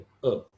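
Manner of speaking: a drawn-out अ pushed from the belly; demonstrating the अ sound; sustained अ from the stomach. Shown as a demonstration. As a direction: hold अ from the stomach. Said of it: "तो आवाज़ वहाँ से आती है".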